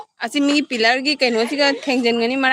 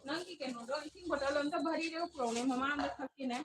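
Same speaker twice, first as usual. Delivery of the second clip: off-mic request; 3 semitones higher, 20 dB quieter than usual. Real, not acted. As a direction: ask someone away from the mic, barely audible. Asking someone nearby for water.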